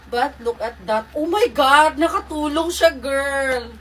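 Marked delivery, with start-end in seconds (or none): 1.1-3.7 high, loud delighted outburst; thrilled, raised-voice exclaiming; excited exclamation. Real, not acted. Delighted by how the dress helps.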